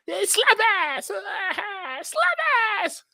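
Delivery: very high squealed catchphrase; sketch-show impression. Streamer quoting a Key and Peele sketch, high squeal. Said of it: "y'all watch Key and Peele".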